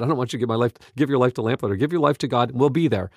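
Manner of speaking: ordinary talk, nothing marked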